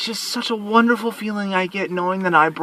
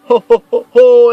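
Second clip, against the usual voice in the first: put-on booming jolly Santa laughing for the kids; 5 semitones higher, 14 dB louder than usual. Shown as a demonstration. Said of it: "the laugh I do for Santa Claus".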